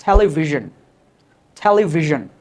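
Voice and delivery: "টেলিভিশন" as TEL-i-vi-zhun; firm model of "টেলিভিশন" with buzzed zh; correct zh pronunciation. Shown as a demonstration. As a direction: end "টেলিভিশন" in -zhun, not -shon. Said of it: "উচ্চারণটা কী হবে দেখো".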